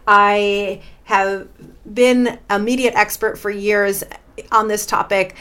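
usual speaking voice

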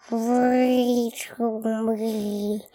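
slow, gurgling monster voice; slow gargoyle voice. Shown as a demonstration. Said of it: "let me do that".

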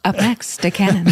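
hammy MC intro; mock announce the next act; announcer voice. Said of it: "announcer type voice".

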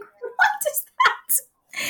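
tiny, very high slow squeal; retold fright. Camper on the toilet hears an animal, mock terrified squeak. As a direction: squeak it out in hammed-up alarm.

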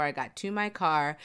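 usual speaking voice

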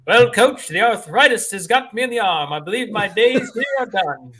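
put-on high, loud old-timey ballplayer; old-timey thirties voice. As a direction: play it like a 1930s ballplayer. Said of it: "my old-timey thirties voice".

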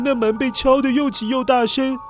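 ordinary delivery

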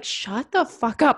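drawn out, deliberate shut-up to her inner voice; mock stern command to the negative voice; slow firm telling-off. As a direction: spell out each word like shutting down a nag. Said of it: "sometimes I'm just like".

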